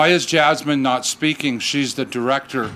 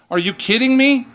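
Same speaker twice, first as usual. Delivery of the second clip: incredulous; 8 semitones higher, 4 dB louder than usual. Real, not acted. Incredulous outburst about wife's remark.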